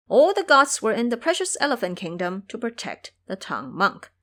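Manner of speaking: ordinary talk, nothing marked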